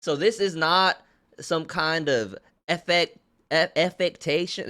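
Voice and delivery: put-on Southern accent. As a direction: play a born Southerner. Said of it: "born and raised in the South".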